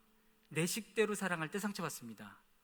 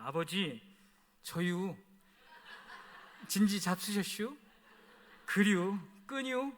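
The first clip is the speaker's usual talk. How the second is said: short, drawled Chungcheong country dialect, 저유 and 잡수셨슈 with the -유 endings; slower than usual. Shown as a demonstration. Son phoning his father in Chungcheong dialect. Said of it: "저는 이렇게 전화를 합니다".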